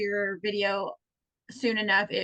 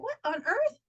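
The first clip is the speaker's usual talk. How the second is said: high-pitched disbelief; retold puzzlement, voice pitched up; baffled exclamation; 10 semitones higher than usual. Finding the dog gone, baffled high exclamation.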